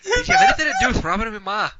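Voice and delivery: Mickey gabbling, laughing through it; rushed mumble. Acted. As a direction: garble it at double speed, laughing. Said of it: "what are you saying?".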